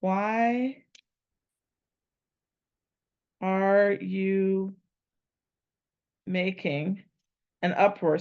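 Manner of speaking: each word set apart with long pauses; slow deliberate translation read. Shown as a demonstration. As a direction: weigh every word slowly, trying the phrasing. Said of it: "So let's try this".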